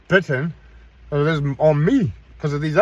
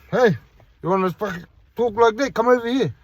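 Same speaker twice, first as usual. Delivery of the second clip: higher, taunting call to come and fight; retold challenge shouted at a stranger; aggressive challenge; 7 semitones higher than usual. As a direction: dare him over, rising.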